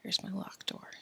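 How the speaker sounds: soft unvoiced whisper; whisper aside. Real, not acted. Narrator setting up a scene, whispering. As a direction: murmur to yourself while setting up.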